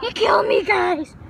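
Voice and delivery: loud, high startled outburst. Real, not acted. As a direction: react with a jolt. Startled by alarm.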